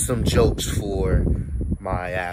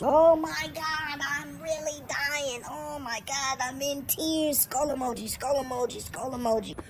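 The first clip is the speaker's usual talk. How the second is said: mock hysterical texter; 5 dB quieter than usual. Friend texting the group chat about dying laughing, mock hysterics. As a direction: melodramatically read out an over-the-top text. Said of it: "one person texting in all caps".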